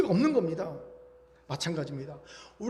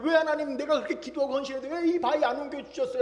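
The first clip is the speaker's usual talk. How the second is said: high, raised mock lament; a congregant's reproachful prayer, pitched up; lamenting complaint; 6 semitones higher, 4 dB louder than usual. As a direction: imitate a believer complaining loudly to God. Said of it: "하나님께 이렇게 한탄하더라고요".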